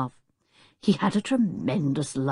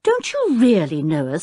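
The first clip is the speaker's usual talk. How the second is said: taken aback, voice raised; startled; 6 semitones higher, 5 dB louder than usual. A narrator's reading. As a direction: pitch jumps up in startled protest. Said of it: "very startled".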